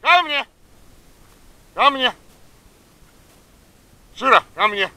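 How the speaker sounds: very high call to a dog. Real, not acted. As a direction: call her back up high. Calling dog from distance.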